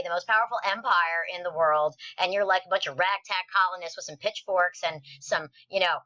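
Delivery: ordinary talk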